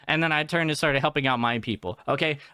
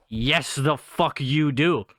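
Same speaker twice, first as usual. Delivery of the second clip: slow, drawn-out mock comeback; emphatic retort; 3 semitones lower, much slower than usual. Fed-up worker answering a customer's language excuse, emphatic drawn-out retort. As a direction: stretch out each word of the imagined retort.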